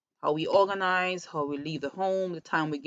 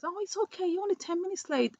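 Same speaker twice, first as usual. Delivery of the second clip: put-on reassuring child; 13 semitones higher, 3 dB quieter, faster than usual. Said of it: "and says".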